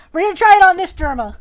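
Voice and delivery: half-panicked loud hype; loud nervous push. Real, not acted. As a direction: psych yourself up loudly. Facing the scary image.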